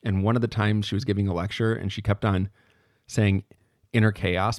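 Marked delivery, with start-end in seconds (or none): none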